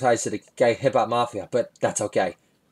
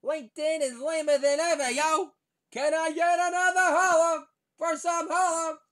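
much higher squeaky rapper voice; hammed-up high rapper impression. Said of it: "merger talk between [name] and [name]".

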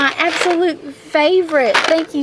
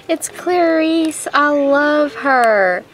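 normal talking voice

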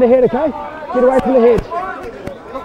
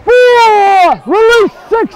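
shouted calls; 6 semitones higher, 4 dB louder than usual. Real, not acted. Referee calling over the play.

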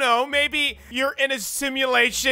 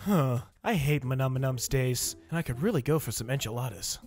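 low lazy cat voice; 13 semitones lower, 7 dB quieter than usual. Pretend. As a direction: grumble it lazily low. Garfield-style lazy cat voice.

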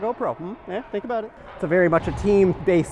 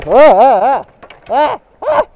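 rising panicked yelps, much louder and higher; startled yelps; 8 semitones higher, 12 dB louder, slower than usual. Real, not acted. Goalie yelping as shots rain down, loud panicked yelps. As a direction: yelp in alarm as the puck flies in.

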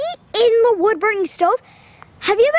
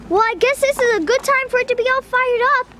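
ordinary talk, nothing marked

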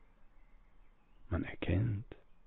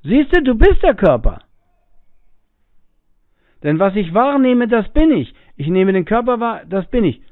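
much higher, louder know-it-all voice; insistent arguer; 10 semitones higher, 9 dB louder than usual. Retold or imitated. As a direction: lecture him like a smug debater. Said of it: "manche sagen von dann".

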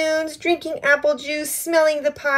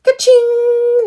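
much higher, louder cash register ring; bright ka-ching; 12 semitones higher, 7 dB louder than usual. Pretend. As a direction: ring it out high like a till. Cash register sound voiced.

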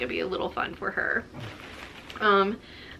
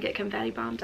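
ordinary talk

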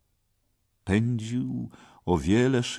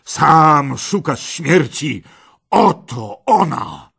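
loud, slow, raised bellow; thundering threat; 9 semitones higher, 12 dB louder, slower than usual. Acted. Red knight charges at Parsival, thundering. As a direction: roar it as you charge. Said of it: "potężnym głosem zagrzmiał".

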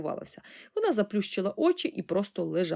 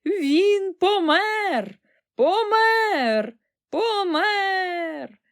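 high, loud, drawn-out lament; hammed-up mourning cry; wailing; 11 semitones higher, 7 dB louder, much slower than usual. A narrator's reading. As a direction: wail the death out, word by word. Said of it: "вона заголосила".

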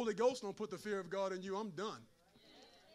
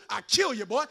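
angry father; 10 dB louder than usual. Retold or imitated. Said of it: "That's your daddy's voice".

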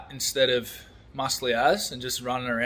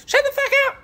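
very high, loud squeaky mock shout; mocking high-pitched Australian outburst; mock Australian yell; 6 dB louder, faster than usual. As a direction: squeal it as a feeble angry Aussie. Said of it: "scarier than when an Australian person goes".

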